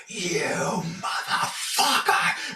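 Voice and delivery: drawn-out, high imitation of a scream; retold scream. Angry truck driver at a passing motorist, retold scream. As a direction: stretch out a mock scream, straining high. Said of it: "like, screaming at me".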